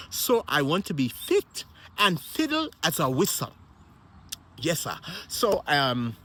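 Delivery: ordinary talk, nothing marked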